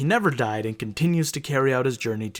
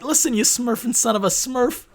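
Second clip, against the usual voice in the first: angry little smurf; 11 semitones higher than usual. Retold or imitated.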